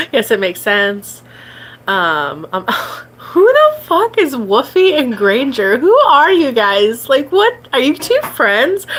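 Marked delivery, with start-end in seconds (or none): 3.2-8.9 amused disbelief. Real, not acted. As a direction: react to the weird names in disbelief. Reacting to odd chat names.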